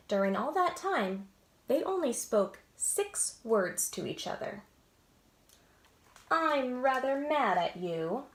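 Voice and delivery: lively storybook read-aloud with voices; animated picture-book narration; reading to children. A narrator's reading. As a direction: read it like bedtime story time.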